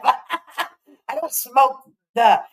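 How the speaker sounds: words slowed by laughter; laughing. Real, not acted. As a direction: laugh through the denial. Laughing at Betty's boogers claim.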